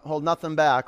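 ordinary talk

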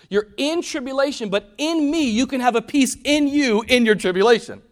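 loud, high preacher's build; fiery sermon climax; preaching at full force. Acted. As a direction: drive it home to the congregation.

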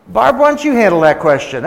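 loud, high-pitched hand-off to wife; passing the buck. Retold or imitated. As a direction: mock-eagerly hand the hard question over. Acted plea handing off to wife.

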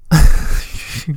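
voiceless wheezing giggle; breathy laugh. Real, not acted. Late-night player about to read the journal, wheezy laugh. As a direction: crack up on a burst of breath. Cracks up before reading journal.

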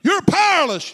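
high, punched taunt; devil's accusation. Acted. Devil insulting the preacher, high accusing jab. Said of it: "When he comes up and say".

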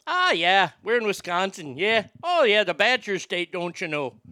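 put-on peppy, lilting Wisconsin accent. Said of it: "A little bit more pep".

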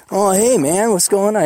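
light imitation of a mellow California guy; a hint of a relaxed California friend; laid-back California accent. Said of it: "He got that California accent".

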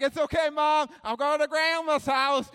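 put-on high, loud, carefree kid voice; cheerful child. Child leaving his crying mom at the bus, gleeful. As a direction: call it out with careless glee.

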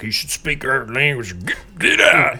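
very high, loud, ranting mock outrage; mock angry yell. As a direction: shout like an outraged bigot. Mocking a xenophobic rant.

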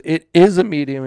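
loud, punched IS; insistent contradiction. Retold or imitated. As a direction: imitate a brother digging in loudly. Brothers argue, acted.

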